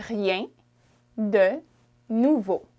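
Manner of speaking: "Rien", "de", "nouveau" split with pauses; word by word. Shown as a demonstration. Teacher splitting the phrase word by word for learners. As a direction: break "Rien de nouveau" into separate words. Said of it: "in French you would say".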